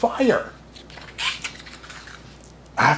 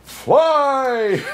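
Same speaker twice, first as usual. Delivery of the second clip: high, louder excited cry; thrilled command; 13 semitones higher, 4 dB louder than usual. Acted. Girl commanding the sleigh to fly, thrilled. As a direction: shout the magic word with glee. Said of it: "thrilled to give the command".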